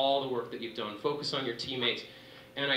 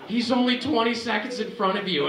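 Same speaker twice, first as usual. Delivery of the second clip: high, loud yell with a laugh; retold coach yell; 9 semitones higher, 7 dB louder than usual. Coach yelling the gap out of transition, loud and high. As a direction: shout the gap like a coach at the roadside. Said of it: "Dave is screaming at me".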